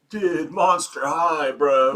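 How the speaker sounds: slow, drawled surfer bro; lazy stoner surfer impression; surfer dude voice. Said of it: "you know, surfers or something".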